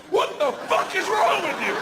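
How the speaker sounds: high horrified wife; appalled wife. Retold or imitated. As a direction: shriek in disgust as the wife. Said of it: "My wife's like".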